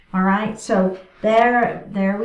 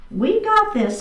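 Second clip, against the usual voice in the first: higher, bright rallying repeat; upbeat encouragement; 10 semitones higher than usual. Real, not acted. Instructor cheering the class on before the other side, higher. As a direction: lift it up to cheer them on.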